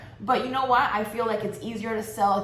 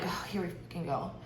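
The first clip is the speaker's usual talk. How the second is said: muttered exasperation; 4 semitones lower, 10 dB quieter than usual. Retold or imitated. Inner reaction to his demand.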